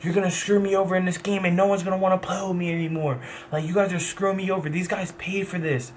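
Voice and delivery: mock his younger self begging mom; whiny teen protest. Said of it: "I'm fucking furious".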